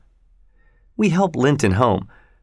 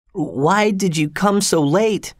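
put-on high, slow, feeble boy voice; weak, high-pitched plea, drawn out; shaky voice; 9 semitones higher, slower than usual. A narrator's reading. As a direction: ask slowly in a high, shaky voice. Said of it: "he asked in a shaky voice".

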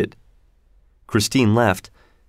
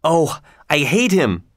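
higher, mildly impassioned outburst; a touch of jealous heat; crying out; 4 semitones higher than usual. A narrator's reading. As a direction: cry out with understated hatred. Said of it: "cried Raoul".

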